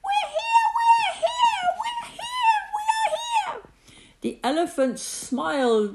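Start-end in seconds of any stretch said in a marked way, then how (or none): 0.0-3.6 tiny, very high chorus cry; high, thin frightened calling; crying out in fear. A narrator's reading. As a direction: squeal it out desperately to be heard. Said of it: "They cried out in fear".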